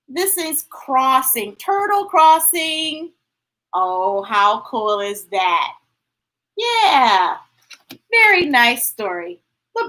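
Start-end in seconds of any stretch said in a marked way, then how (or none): none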